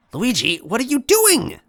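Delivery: put-on high, loud, slow cry of a small girl; Toadette shouting. A narrator's reading. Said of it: "Toadette shouted".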